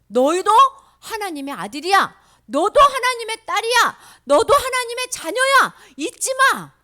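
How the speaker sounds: loud, high, emphatic proclamation; rousing affirmation. Acted. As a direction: drive each promise home to the children. Voices Jesus exhorting the children.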